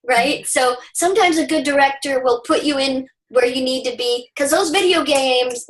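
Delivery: ordinary talk, nothing marked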